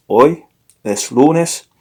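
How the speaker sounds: «Hoy es lunes» said slowly, word by word; slow model sentence. Shown as a demonstration. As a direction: spell out «Hoy es lunes» slowly, as a teacher would.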